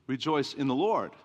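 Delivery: usual speaking voice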